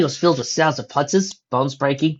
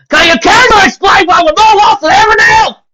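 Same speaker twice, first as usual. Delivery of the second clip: shrill frustrated yell, much higher; exasperated shout; 14 dB louder than usual. Acted. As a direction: howl it out in frustration. Exasperated cry at being lost.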